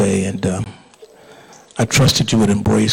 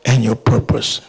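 impassioned pulpit prayer, swelling then settling; fervent prayer; 2 semitones higher, 3 dB louder than usual. Real, not acted. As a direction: plead with God on the congregation's behalf. Leads the congregation in prayer.

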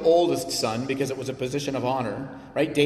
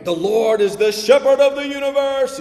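booming epic-trailer voice, much higher and louder; hammed-up grandiose declaration; mock grand announcer; 10 semitones higher, 9 dB louder than usual. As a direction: proclaim it like a cosmic movie announcer. Mocking a grand, impersonal God.

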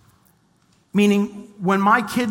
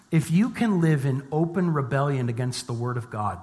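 ordinary delivery

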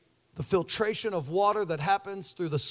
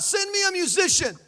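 much higher, called-out demand; screaming out; 10 semitones higher than usual. Retold or imitated. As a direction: cry out for the musician. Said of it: "he screams out".

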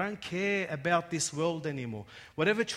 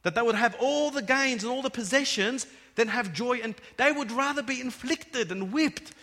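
preaching at full force; 7 semitones higher, 7 dB louder than usual. Acted. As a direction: hammer it home to the congregation. Preaching loud again.

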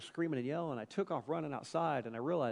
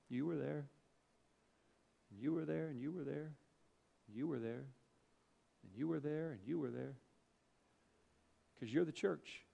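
sincere, quiet; 7 dB quieter than usual. Acted. Quiet, slow emotional sermon passage.

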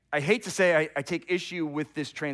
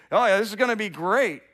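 loud, high mock enthusiasm; mockery; 6 semitones higher, 6 dB louder than usual. Soldiers gambling for Jesus's clothes, acted mockery. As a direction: lightly play a scoffer relishing the prize. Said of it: "they're making a mockery of him".

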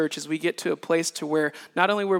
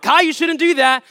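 warning to son; 11 semitones higher, 14 dB louder than usual. Retold or imitated. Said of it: "But I'm like".

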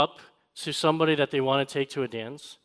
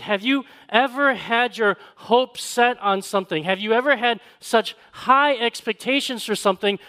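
raised, louder preacher voice; preaching; 6 semitones higher, 4 dB louder than usual. Acted. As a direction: build it up from the pulpit. Preaching at full force.

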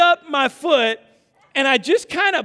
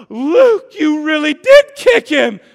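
loud, high mock outrage; shocked accusation; 5 semitones higher, 11 dB louder, slower than usual. Husband mimicking wife blaming him, appalled shout.

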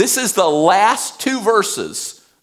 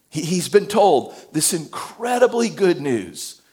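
ordinary delivery

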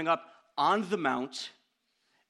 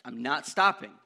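a raised retold refusal from the brother; mock yell; 2 semitones lower, 6 dB louder than usual. Brother refusing to stop for gas, a mild mock yell. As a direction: bark the refusal, half-acted. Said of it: "I think he might have yelled".

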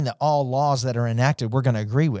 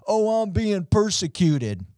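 mock whine; 6 semitones higher, slower than usual. Mocking complainers.

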